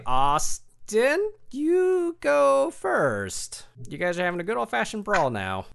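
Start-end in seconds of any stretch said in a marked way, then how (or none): 0.1-3.7 drawn-out announcement. Acted. GM announces first turn.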